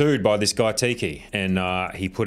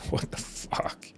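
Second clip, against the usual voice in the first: slow, stunned whisper; whispered disbelief; 11 dB quieter, slower than usual. Real, not acted. Host reacting to a shocking tweet, whispered disbelief. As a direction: whisper it slowly under your breath in disbelief.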